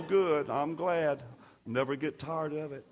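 normal talking voice

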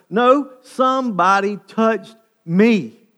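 loud, high, slow and weighty; emphatic insistence. Acted. Jesus refusing to move on until he knows, emphatic. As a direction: stop everything with a drawn-out, forceful demand. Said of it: "And say".

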